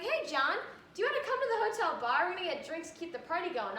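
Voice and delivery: friendly invite. Retold or imitated. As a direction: chirp the party invitation. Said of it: "they'll be like".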